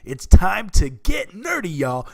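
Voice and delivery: big booming announcer hype, slow and loud; booming kids show opener. Acted. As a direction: open the show like a ringmaster. Podcast intro hype opener.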